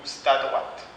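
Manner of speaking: "c'est" said as "st" in "c'est à droite"; spoken st before à. Shown as a demonstration. Teacher models reduced "c'est à droite". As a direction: say "st à droite", vowel dropped. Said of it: "c'est possible de dire".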